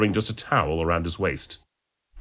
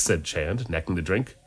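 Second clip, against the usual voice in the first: ordinary delivery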